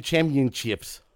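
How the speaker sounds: "championships" slowly as cham-yun-chips; imitated mispronunciation. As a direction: mimic him dropping the P in "championships". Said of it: "that's how he does it".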